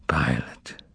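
soft unvoiced whisper of the name. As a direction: breathe the name as you drift off. Repeats the name, falling asleep.

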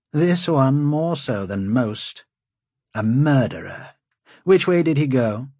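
proud, mocking lord; haughty lord. A narrator's reading. Lord Wold lectures.

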